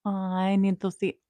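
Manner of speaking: ordinary talk, nothing marked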